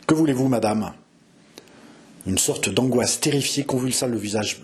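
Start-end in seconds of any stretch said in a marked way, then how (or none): none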